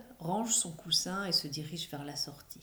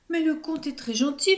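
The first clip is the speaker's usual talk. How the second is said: pleading, higher and louder; defending; 8 semitones higher, 5 dB louder than usual. A narrator's reading. Justine defending the count to the director, pleading. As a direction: stand up for him earnestly. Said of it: "le défend Justine".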